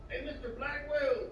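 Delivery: put-on a teen greeting the teacher in a Mexican accent. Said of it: "I love that real Mexican accent".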